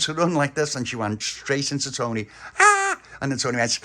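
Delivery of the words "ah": high, loud scream. Acted. Stella running straight into Tony, loud scream. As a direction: shriek on impact.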